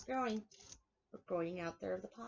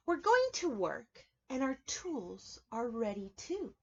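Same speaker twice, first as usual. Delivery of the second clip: storybook reading; 3 semitones higher than usual. A narrator's reading. Reading picture book aloud.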